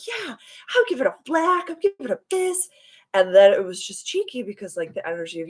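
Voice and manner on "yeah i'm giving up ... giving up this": very high, giddy sing-out; jovial. Acted. Driver gladly telling spirit guides what she gives up, jovial. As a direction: cheer it way up high, hammed-up jovial. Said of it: "I was jovial".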